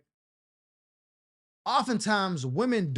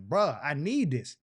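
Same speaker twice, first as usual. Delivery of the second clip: slow, savoring bruh; slow awed aside; 3 semitones lower, slower than usual. Retold or imitated. Man telling himself he's hooked, slow and savoring. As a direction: draw it out, hooked. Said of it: "I was like".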